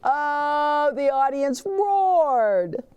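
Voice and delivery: ordinary delivery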